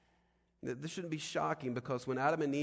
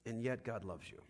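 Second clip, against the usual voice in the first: tender contrast; 5 dB quieter than usual. Acted. Softer answer after loud build.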